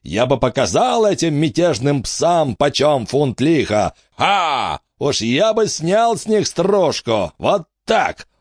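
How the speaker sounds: lightly voiced greedy old tour guide; pompous showman. A narrator's reading. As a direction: hawk the battle show like a carnival barker. Wicks blusters to the crowd.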